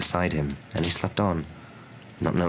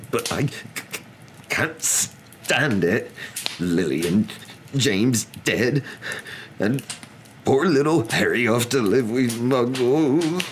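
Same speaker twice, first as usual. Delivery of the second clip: higher, halting, tearful stammer building to a wail; sobbing; 6 semitones higher, slower than usual. A narrator's reading. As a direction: blubber it out, dragging the words. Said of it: "sobbed Hagrid".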